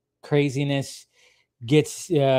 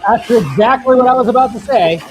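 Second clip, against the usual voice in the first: much higher, louder eager burst; excited agreement; 10 semitones higher, 11 dB louder than usual. Real, not acted. Agreeing with co-host about the Flash movie, excited. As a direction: jump in, thrilled you thought the same.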